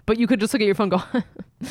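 usual speaking voice

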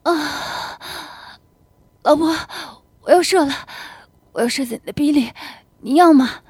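urgent, breathless husband's cry; climactic urgency. Acted. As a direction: push words out between gasps.

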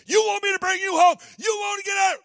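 put-on high, loud, fast furious scolding of a child; angry scolding shout. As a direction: yell at the crying boy to shut him up. Mother scolding crying child in line.

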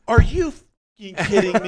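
high, loud disbelieving yell; a startled realtor shouting in disbelief; incredulous shout. Retold or imitated. Realtor reacts to crawl space.

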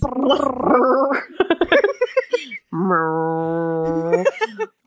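long buzzing lip trill; imitated vibrating. Co-host acts out resonating boobs, imitated buzzing. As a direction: imitate something vibrating with a drawn-out brrr. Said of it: "my small boobs are vibrating".

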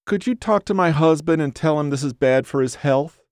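high-pitched whiny appeal; whiny woman. A narrator's reading. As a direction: plead with a stranger in a high nagging whine. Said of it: "the whiny voice".

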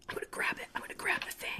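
hushed, voiceless whisper; low-volume whispered aside. Real, not acted. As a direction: whisper it very softly, almost to yourself. Player murmurs intended move at table.

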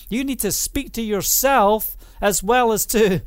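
ordinary delivery

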